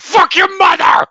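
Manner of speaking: sudden high shouted curse; imitated tic shout. Customer with Tourette's shouting at the clerk mid-deal. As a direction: blurt it out in a loud high yell. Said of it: "but he's like".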